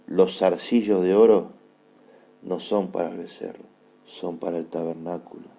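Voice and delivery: low, soft, solemn offering; hushed prayerful preaching. Real, not acted. Preacher offers his ears to the Lord, hushed and prayerful.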